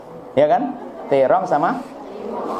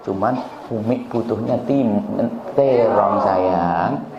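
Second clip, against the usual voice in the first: gentle mother; 4 semitones lower, slower than usual. Retold or imitated. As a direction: correct the child tenderly. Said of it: "enak begitu". (ibu membetulkan anak dengan pelan dan lembut)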